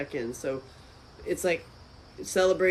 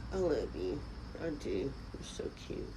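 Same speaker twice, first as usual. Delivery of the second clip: cooing to pet; 3 semitones lower, 12 dB quieter than usual. Real, not acted.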